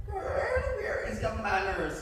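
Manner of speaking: slow, shocked grandma; scolding grandma. Acted. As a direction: scold her manners.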